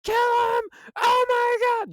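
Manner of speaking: high, piercing panicked shriek at the top of the lungs; shrieking in horror as her friend turns; frantic scream. Retold or imitated. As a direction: scream it in sheer terror, very high and loud. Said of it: "Selena's like".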